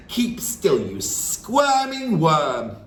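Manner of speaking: high, loud, slow on the insult; contemptuous snarl. Acted. Headmistress snarling at the dangling boy, loud. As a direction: crush the wriggling boy. Trunchbull holds boy aloft.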